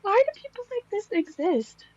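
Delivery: high wail of disbelief; real, high-voiced dismay; high-pitched dismay. Real, not acted. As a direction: squeal it in disbelief. Dismayed reaction after laughing fit.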